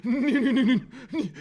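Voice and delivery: high, laughing string of no; giggling denial. Real, not acted. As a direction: protest through laughter. Laughing protest at host.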